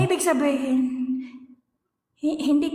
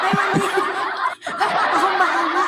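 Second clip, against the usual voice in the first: laughing; 3 semitones higher, slower than usual. Real, not acted. Teasing laugh.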